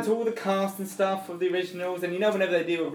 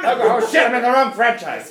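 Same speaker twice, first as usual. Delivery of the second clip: hammy villain voice; 6 semitones higher, 6 dB louder, slower than usual. Pretend. Mock villain swooping in.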